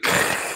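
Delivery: harsh throaty KHH noise; exaggerated guttural hiss, mimicking Arabic sounds; imitated Arabic throat hiss. Shown as a demonstration. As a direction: scrape out a mock Arabic KH. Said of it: "you have to hiss at people".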